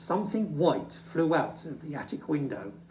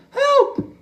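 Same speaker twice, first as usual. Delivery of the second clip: very high, loud desperate call; cry for help; 10 dB louder than usual. Acted. Little Bear stuck in the snow, shouting high and loud. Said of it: "he cried".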